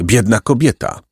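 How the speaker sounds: plain reading voice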